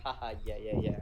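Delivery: low, forced joking laugh-it-off; playing it off. Boy dodging a girl's crush, playing it off as a joke. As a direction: brush it off with a low fake chuckle. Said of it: "pull it off as a joke".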